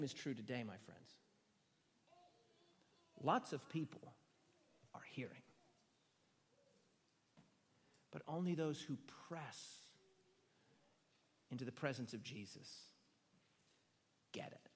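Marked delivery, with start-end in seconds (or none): 3.2-14.7 dropped to a near whisper, much softer; softer and softer until only a whisper is left; hushed. Shown as a demonstration. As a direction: lean in and hush it, fading to a whisper. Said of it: "same line said again, hushed".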